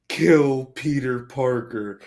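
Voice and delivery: drawn-out, slow, dark demand; slow sinister prayer to God; slow menacing plea. Retold or imitated. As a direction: let it crawl out like a villain's prayer. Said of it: "And it's like".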